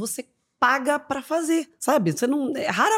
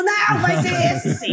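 group insisting; 11 semitones higher than usual. Retold or imitated. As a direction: overrule her firmly. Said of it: "Todo mundo". (todo mundo insistindo alto)

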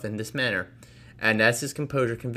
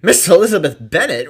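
much louder, higher astonished echo; shocked repeat; 7 semitones higher, 12 dB louder than usual. Acted. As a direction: Miss Bingley echoes the name in disbelief. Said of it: "repeated Miss Bingley".

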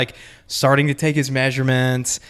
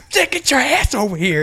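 much higher, mock furious summons; angry boss; 10 semitones higher than usual. Boss storming back to yell at his clerk, mock furious. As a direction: bark him over in mock rage.

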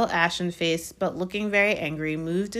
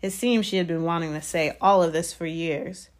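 ordinary delivery

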